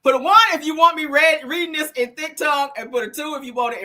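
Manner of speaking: laughing through the words; cracking up while talking. Real, not acted. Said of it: "I'm crying".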